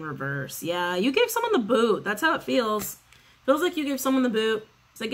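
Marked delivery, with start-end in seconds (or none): none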